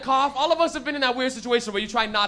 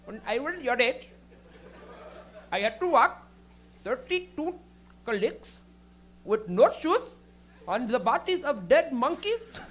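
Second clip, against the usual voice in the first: put-on halting Indian-accented storytelling; mock Indian accent, pausing between phrases; old Indian lady; slower than usual. Comic as stoned old lady reminiscing, mock Indian accent. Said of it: "high with an old Indian lady".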